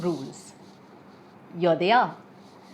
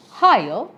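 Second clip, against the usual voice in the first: "higher" punched louder on HIGH; higher stressed syllable; 3 semitones higher, 7 dB louder than usual. Shown as a demonstration. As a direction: demonstrate the word "higher" with a loud, raised first syllable. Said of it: "the stressed syllable should be".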